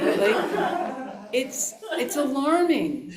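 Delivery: laughing through the words. Real, not acted. Teacher laughing at a hard truth, laughing. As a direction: laugh while calling it alarming.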